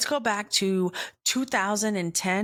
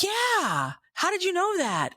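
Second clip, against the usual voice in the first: much higher, delighted surprise; amazed exclaim; 11 semitones higher than usual. Retold or imitated. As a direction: marvel at being understood. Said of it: "And I'm like".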